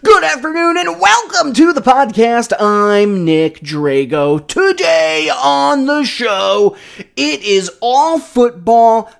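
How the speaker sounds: high, slow, announcing the show; host intro voice. Acted. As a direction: open the show like a radio host. Podcast host opening.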